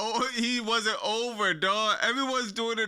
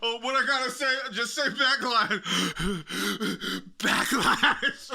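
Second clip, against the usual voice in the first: mock winded, panting between phrases; Triple H. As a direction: act freshly interrupted and out of breath. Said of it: "He come out the door sweating".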